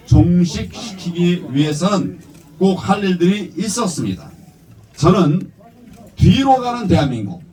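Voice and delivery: rousing political speech; formal speech. Real, not acted. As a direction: deliver it like a campaign address. Prepared speech read at rally.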